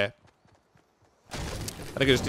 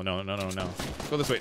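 ordinary talk, nothing marked

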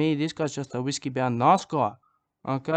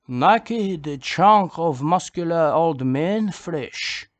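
very slow, each word savoured; slow, grand proclamation; 4 dB louder, much slower than usual. Acted. To the audience on his cue, slow grand proclamation. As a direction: unveil the flesh like a museum piece. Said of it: "that's my cue".

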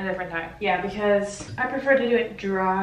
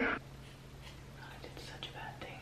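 slow, hushed regretful whisper; 18 dB quieter, slower than usual. Real, not acted. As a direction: whisper it in shame. Regret after bad cut.

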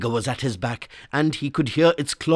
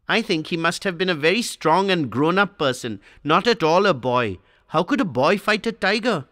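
lightly voiced boy, higher, doubting his father; a small boy arguing the tiger story with his father, higher voice; Swami disputing; 6 semitones higher than usual. A narrator's reading. Boy questioning father about the tiger, lightly higher child voice.

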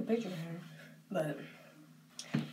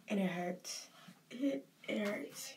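real grief, soft and halting; hurting; 8 dB quieter, slower than usual. Real, not acted. Grieving owner on flashbacks of the dog, quietly hurting. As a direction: let it trail into a soft, pained near-whisper.

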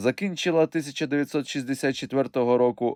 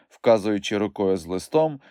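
usual speaking voice

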